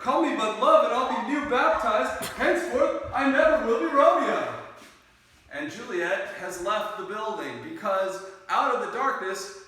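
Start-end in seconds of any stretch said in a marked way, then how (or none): 0.0-4.5 bold Romeo. Acted. Romeo boldly reveals himself to Juliet, loud bold declaration. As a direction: call it out bravely from the dark. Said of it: "He finally gets brave and he steps out".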